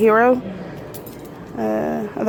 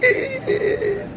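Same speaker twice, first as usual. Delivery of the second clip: higher, slow, gushing squeals of joy; bursting with excitement; 7 semitones higher, slower than usual. Real, not acted. Vlogger to viewers over her new GoPro, bursting with excitement. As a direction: let the thrill spill out, drawn out with a laugh. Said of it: "I just needed to let that out".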